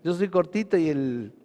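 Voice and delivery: normal talking voice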